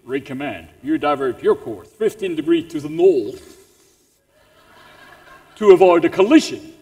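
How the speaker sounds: low, commanding British naval voice; English accent. Acted. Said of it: "better than the English accent".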